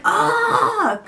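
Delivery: imitating a kid's high, loud frustrated "ah"; mimicking her son losing patience; frustrated groan. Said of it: "out of frustration".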